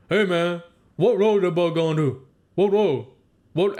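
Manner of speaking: imitating the old guy's mumble. Retelling the old man at the bus stop, mild mumbling imitation. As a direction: lightly mimic his hard-to-follow slurred words. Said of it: "he would talk like this".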